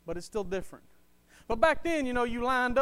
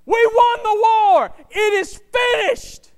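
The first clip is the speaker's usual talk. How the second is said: loud, high victory cry, drawn out; slow, full-force bellow of triumph; triumphant shout; 13 semitones higher, 17 dB louder, slower than usual. Acted. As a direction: shout it across the battlefield. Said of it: "he's probably stood somewhere and shouted".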